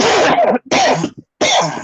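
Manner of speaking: rough chesty cough; harsh cough. Real, not acted. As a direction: hack out a sick cough. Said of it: "That's a nasty cough you got there".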